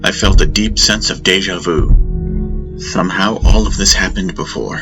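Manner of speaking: ordinary delivery, nothing marked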